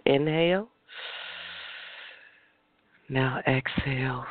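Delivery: guided breathing. Acted. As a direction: draw out each breath cue. Leads breathing exercise.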